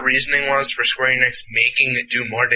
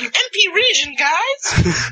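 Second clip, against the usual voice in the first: way up high, squeaky shout-out; high squeaky call; slower than usual. Retold or imitated. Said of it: "it's just like".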